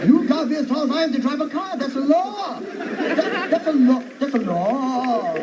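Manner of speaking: high mock Chinese accent, laughing through it; hammed-up old Chinese man laying down the law. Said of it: "Chinese people don't talk like that".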